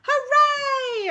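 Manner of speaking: very high, loud crowd cheer; delighted hooray. Acted. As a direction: shout it as a whole school. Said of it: "everyone cheered".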